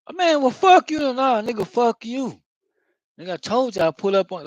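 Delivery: cuss her out in a high, riled-up voice; mock angry cursing. Retold cursing at YouTube threatener.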